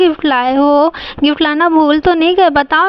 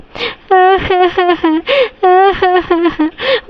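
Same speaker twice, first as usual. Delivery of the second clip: put-on child sobbing; 4 semitones higher than usual. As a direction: cry like a scolded little girl. Girl crying before parents.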